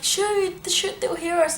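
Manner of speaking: high, squeaky imitation of urgent shushing; hushing in panic. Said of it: "make me be quiet".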